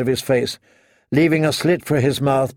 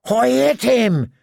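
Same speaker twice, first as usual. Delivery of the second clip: slow, much higher cry of disbelief; he can't believe his own shot; shocked; 9 semitones higher, slower than usual. A narrator's reading. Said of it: "Sir Piggy sounded shocked".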